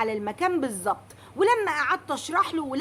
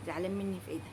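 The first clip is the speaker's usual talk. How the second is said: much softer, low incredulous mutter; muttered aside; 7 semitones lower, 11 dB quieter, much faster than usual. Acted. Customer muttering at the courier, much softer. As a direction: grumble it half to herself. Repeats it again under her breath.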